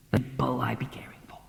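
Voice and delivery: low whispered mutter; whispered aside. Acted. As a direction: say it to yourself, barely there.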